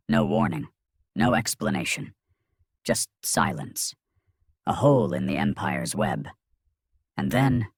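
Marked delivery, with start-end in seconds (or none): none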